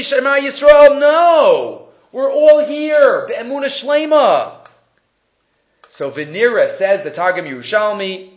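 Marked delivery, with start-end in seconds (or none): 0.0-4.6 high, loud, drawn-out proclamation; sons' insistent reassurance, retold at full voice; emphatic declaration. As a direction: declare it loudly and slowly, pitched up. Sons reassure their worried father.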